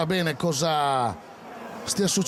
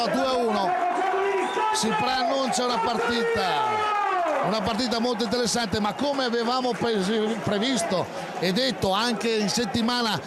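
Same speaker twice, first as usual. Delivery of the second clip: high, excited match commentary; breathless italian goal commentary; 5 semitones higher than usual. Acted. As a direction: keep the energy up after the goal. Live commentary after goal.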